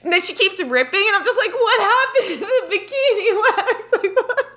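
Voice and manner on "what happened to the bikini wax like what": high-pitched protest through laughter; retold high squeal of confusion; can't talk normally. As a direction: act out helpless, laughing bewilderment. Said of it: "can't even, like, talk normally".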